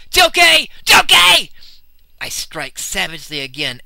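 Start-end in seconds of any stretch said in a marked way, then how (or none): 0.1-1.5 piercing scream, far higher and louder; screaming a name. Acted. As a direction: scream the name in rage and grief. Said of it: "with a scream of".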